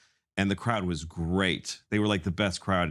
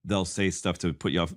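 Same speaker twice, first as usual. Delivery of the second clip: ordinary talk, nothing marked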